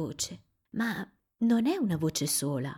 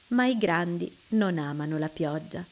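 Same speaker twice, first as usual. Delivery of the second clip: ordinary delivery, nothing marked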